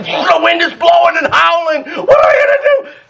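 high, crying mock panic of frightened sailors; panicked disciples. Preacher acting the disciples' panic in the storm, high cry. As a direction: cry out as if the boat is sinking.

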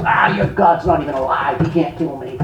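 low, dismissive scoff, lightly imitated; soft, low mock defiance; mock blaspheming. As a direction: imitate a scoffer brushing God off under his voice. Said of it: "Tony just blasphemes".

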